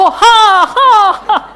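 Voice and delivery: high, loud, laughing filmy yes repeated; melodramatic old movie yes. Retold or imitated. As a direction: overplay the heroine's triple yes. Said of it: "एक बार बोल के नहीं होती थी".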